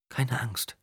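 soft, high murmur. A narrator's reading. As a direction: murmur it softly. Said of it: "murmelte sie".

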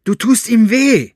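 loud, high-pitched protest, trembling; trembling outburst. A narrator's reading. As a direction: cry out to protect the boy. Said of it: "mit zitternder Stimme an". (lauter, hoher, zitternder Protest)

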